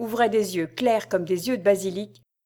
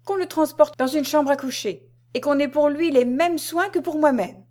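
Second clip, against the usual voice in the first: higher-pitched lordly order; pitched-up commanding urgency; urgent command; 7 semitones higher than usual. A narrator's reading. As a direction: order servants about with feigned alarm. Regent urgently orders servants to act.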